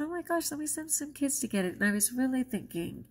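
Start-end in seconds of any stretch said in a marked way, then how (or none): 0.0-1.7 high-pitched excited gasp; bright burst of joy. Retold or imitated. Excited reaction retold.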